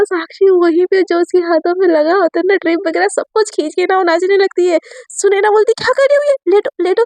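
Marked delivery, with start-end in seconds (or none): 5.7-7.0 alarmed. A narrator's reading. Sakshi pulls out drip, dances.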